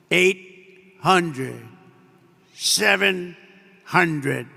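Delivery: slow, spaced-out cockpit callout; a hint of the machine counting down; computer altitude voice. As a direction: lightly imitate the plane's automated voice. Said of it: "you have the computer voice".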